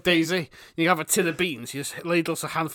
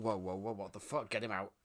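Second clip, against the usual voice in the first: very low, much softer aside; quiet, low-pitched mock disbelief; hushed mock alarm; 8 semitones lower, 17 dB quieter than usual. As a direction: imitate appalled barbers under their breath. Acts out barbers' appalled reaction.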